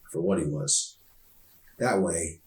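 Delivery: ordinary delivery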